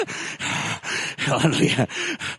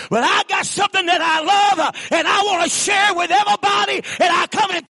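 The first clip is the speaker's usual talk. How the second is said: preaching at full force; 6 semitones higher than usual. Acted. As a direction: preach it out to the whole church. Preacher building up about Jesus.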